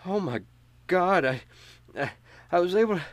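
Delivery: high, stunned gasp of horror; horrified disbelief, voice shooting up; shocked. Acted. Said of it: "and she says".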